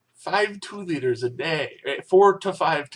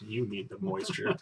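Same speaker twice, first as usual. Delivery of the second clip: mocking echo; 7 semitones lower, 12 dB quieter than usual.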